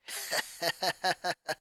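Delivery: soft, brief bitter laugh; a quiet scornful laugh; small laugh. Acted. Boy laughs softly at his father's command. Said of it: "The boy gave a small laugh".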